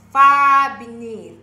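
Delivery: far beneath, far hit hard and loud; teacher belting the key word far; slow loud far. Shown as a demonstration. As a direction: punch far, drag the whole phrase. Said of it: "slow emphatic demo".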